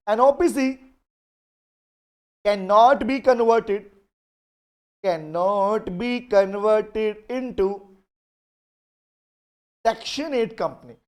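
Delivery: each phrase spaced out very slowly, word by word; slow dictation. Acted. Teacher slowly dictating a law clause to the class. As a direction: dictate clearly so students can write it down. Dictating a legal provision to students.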